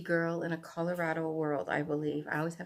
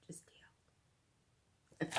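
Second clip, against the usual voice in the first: breathy whispered aside; 19 dB quieter than usual. Real, not acted. Telling viewers to live with it, whispered.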